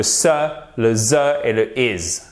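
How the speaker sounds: SE hissed, THE as zeu, IS as izz; three S sounds. Shown as a demonstration. Teacher recaps three sounds for students, demonstrative pronunciation. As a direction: sound out each ending separately. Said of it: "il y a trois sons S".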